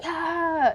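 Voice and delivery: high celebratory "ah"; imitated cheer. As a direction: mimic a triumphant cheer, high-pitched. Cheer she expected from assistant.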